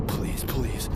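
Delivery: ordinary talk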